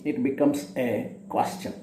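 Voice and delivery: ordinary delivery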